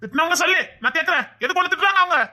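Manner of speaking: husband angrily asking who dared scold his wife; indignant demand. Acted. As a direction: demand names, ready to confront them.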